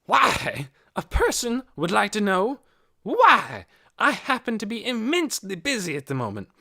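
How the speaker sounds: loud, high indignant ranting; railing. A narrator's reading. Hoyt railing at Missus Beeson before the officers, indignant. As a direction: rant in rising indignation. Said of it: "Hoyt railed on".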